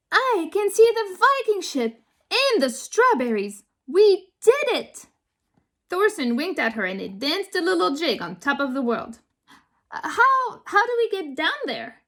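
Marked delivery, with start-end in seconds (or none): 0.1-5.1 high, delighted exclamation; bursting with triumph; excited child. A narrator's reading. Lily cheers at seeing the Viking ship, high and delighted. 9.9-12.0 nervous. A narrator's reading. Lily nervous on cliff top.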